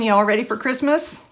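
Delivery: normal talking voice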